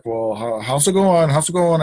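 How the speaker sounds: imitating the boss pressing for news; anxious boss. Boss hovering over the repair, pushy and anxious.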